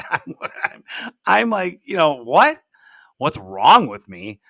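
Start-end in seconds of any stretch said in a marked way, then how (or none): 2.2-4.4 taken aback. Retold or imitated. Son bristles at mom's challenge.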